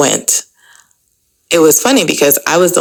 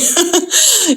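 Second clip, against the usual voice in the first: laugh. Real, not acted. Laughs at her own remark.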